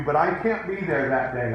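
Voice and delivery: ordinary talk, nothing marked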